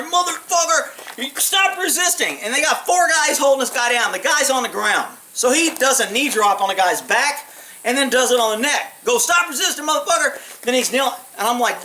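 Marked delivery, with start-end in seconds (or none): 0.0-2.4 imitated angry cop yell. Macho cop kneeing the suspect. 9.2-10.4 imitated angry cop yell. Macho cop repeats the knee drop.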